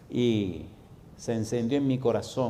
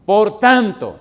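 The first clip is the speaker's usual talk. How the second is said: "Por tanto" thundered out, much louder and higher, falling hard on "tanto"; booming emphasis; 8 semitones higher, 14 dB louder, much slower than usual. Shown as a demonstration. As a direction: proclaim "Por tanto" like a herald, full voice. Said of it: "me gusta cómo lo dice".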